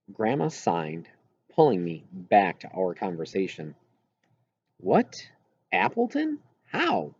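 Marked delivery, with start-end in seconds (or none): 4.8-7.1 surprised questions. A narrator's reading. Narrator voices surprised questions.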